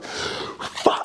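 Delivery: whispered curse. Real, not acted. Realizing his mistake while driving.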